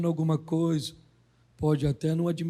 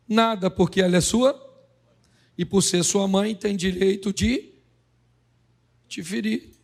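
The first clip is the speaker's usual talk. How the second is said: louder, hammering rhetorical questions; forceful preaching; 4 dB louder than usual. Real, not acted. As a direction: drive it home from the pulpit.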